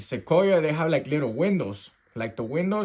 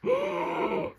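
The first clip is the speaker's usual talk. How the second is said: very high thin gasp; imitated little breath; 4 dB quieter than usual. Man recounting breathing in the corner, imitated gasp. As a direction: suck in a small breath of air. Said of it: "little breath".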